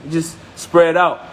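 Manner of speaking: normal talking voice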